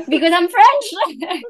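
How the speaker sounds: amused, laughing at the absurdity. Retold or imitated. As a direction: laugh out the punchline, slowly. Laughing through retold punchline.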